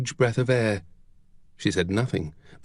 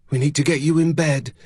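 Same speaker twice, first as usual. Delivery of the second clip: brisk, practical housekeeper; a slightly raised, businesslike voice; 4 semitones higher, faster than usual. A narrator's reading. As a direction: take charge briskly. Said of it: "the housekeeper said briskly".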